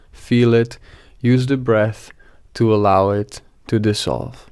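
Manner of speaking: calm meditation guide with long pauses; guided meditation. Acted. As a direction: gently lead them into relaxation, phrase by phrase.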